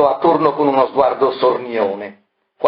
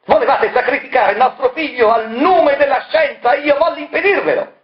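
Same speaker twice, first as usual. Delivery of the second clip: high, strained accusing cry; furious outburst; 14 semitones higher than usual. Acted. Marella raving at Variconi, high furious outburst. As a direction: spit out the accusation. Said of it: "Quel nuovo scatto".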